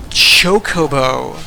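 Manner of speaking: "Chocobo" said slowly with sh start; careful slow "Chocobo" as "Shokobo"; slow deliberate "Chocobo"; correcting the name. Shown as a demonstration. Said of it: "I'm gonna have to say it".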